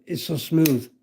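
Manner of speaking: normal talking voice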